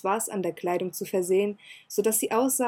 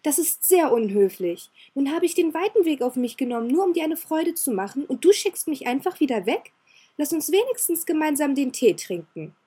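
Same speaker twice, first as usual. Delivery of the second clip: put-on higher girlish voice, hurt and scolding; indignant girl; 6 semitones higher, 4 dB louder than usual. Elizabeth scolding Ciel for sending her away, indignant. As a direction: pout and demand he let her stay. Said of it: "unterbrach ihn Elizabeth aufgebracht".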